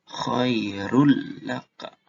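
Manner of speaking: "khairul laka" slow, N merged into L; tajwid demo. Shown as a demonstration. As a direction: stretch "khairul laka" with a held doubled L. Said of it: "Jadi bukan khairun laka".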